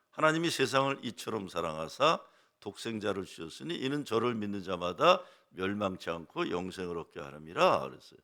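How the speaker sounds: ordinary talk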